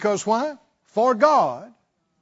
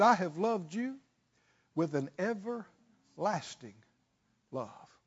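softer and softer, fading to a hush; tender; 9 dB quieter than usual. Acted. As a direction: let God's promise sink in gently. Quoting God's tender promise.

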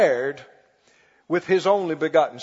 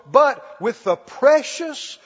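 higher, louder, slow build to "the Lamb"; preaching climax; 4 semitones higher, 6 dB louder, slower than usual. Acted. Preacher lifting the congregation to the blood of the Lamb, loud and slow. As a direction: rise to a ringing peak of the sermon.

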